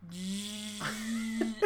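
long low air-leak noise; imitated deflating float. Co-host acting out the needle in the pool floaty, deflating noise. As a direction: make the drawn-out noise of a punctured float.

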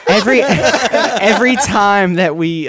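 talking through laughter; laughing. Real, not acted. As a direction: laugh it out, slow. Laughing while starting answer.

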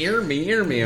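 a hint of Jamaican patois; mock Jamaican. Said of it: "It's the Jamaican".

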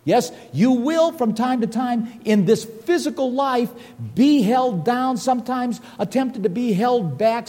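rising, lifted preaching voice. Acted. Preacher exhorts the congregation about trials, building fervor.

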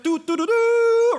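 high loud slow mock trumpet; hummed fanfare. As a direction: sing a herald's fanfare. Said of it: "Trumpets now".

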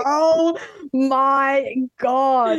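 high, very slow, drawn-out astonishment; long amazed Oh my God; stunned oh my god. Retold or imitated. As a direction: relive the stunned gasp, slowly. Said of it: "And I was like".

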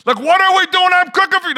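high, very loud exasperated yell; frustrated parent's high-pitched shouted outburst; losing it. Retold or imitated. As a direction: blow up at the kids in full-volume outrage. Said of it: "I just sort of lose my mind".

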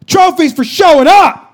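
high, loud coach's scolding; stern coach bark. Retold or imitated. Coach scolding the team, loud and stern. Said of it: "he goes".

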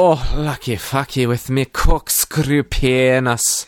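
exultant hammed-up Scottish brogue; Scrooge. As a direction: crow it in a broad Scottish accent. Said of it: "Scrooge is psyching himself up".

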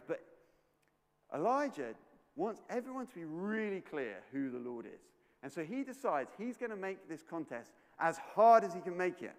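ordinary talk, nothing marked

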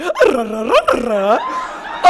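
mock delighted laugh with a rise; happy laugh. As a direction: laugh along without understanding. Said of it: "me just going".

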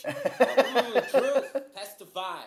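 high, loud call-and-response. Retold or imitated. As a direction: testify like a church congregation.

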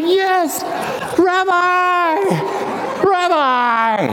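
high ecstatic cry, breaking into a laugh; overjoyed, clinging to him; joyful cry. Acted. Mary grabs Jesus around the neck, high joyful cry.

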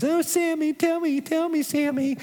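high, pleading wife's voice; mock-whiny woman voice; best woman voice. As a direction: whine it up high like a wheedling wife. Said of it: "it's my best woman voice I've got".

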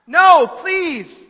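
high, loud, desperate plea; begging. A narrator's reading. Former rebel watching the blows fall, begging loudly. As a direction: beg at full pitch and volume. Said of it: "the former rebel begs".

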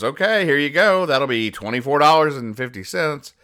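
postal clerk. Retold or imitated. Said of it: "She hands them to him and goes".

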